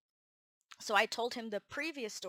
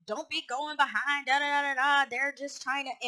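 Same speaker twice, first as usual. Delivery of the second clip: loud, higher-pitched mock rant; vocal complaint; 6 semitones higher, 7 dB louder than usual. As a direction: imitate a boss griping, running on without detail. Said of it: "he was very vocal about it".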